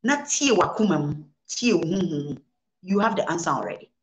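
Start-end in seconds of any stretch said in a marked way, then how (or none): none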